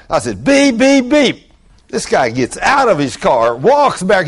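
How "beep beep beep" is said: high loud beep beep beep like a car horn; playful horn imitation; mock horn beeps. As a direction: honk it out with your voice. Imitating car horn honks again.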